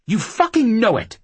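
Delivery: furious yell, high and loud; angry shout. Acted. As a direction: spit it out in rage. Woman yelling in a fight.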